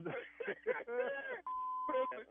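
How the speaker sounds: relieved laugh bursting out; laughing after being pranked; high burst of laughter. Real, not acted.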